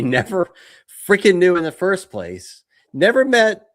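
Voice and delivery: building exasperated rant. Real, not acted. Ranting about dumb plot.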